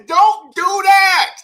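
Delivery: high, slow, loud scolding; hammed-up yell telling the chatter to stop; mock angry shout. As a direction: scold him like a fed-up parent, half joking. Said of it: "I was ready to get mad at you".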